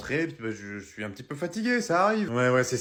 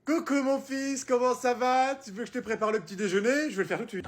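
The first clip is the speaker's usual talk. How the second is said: sweet, much higher mother voice, softening at the end; a syrupy mum cooing over her son; mother character; 10 semitones higher than usual. Acted. Mother cooing at her son in the night, high sugary voice.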